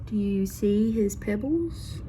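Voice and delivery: usual speaking voice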